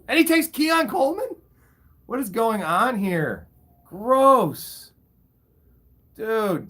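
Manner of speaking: can't believe the pick, then a disgusted "Gross"; shocked outburst. Real, not acted. Streamer reacting to a rival's draft pick, shocked and disgusted.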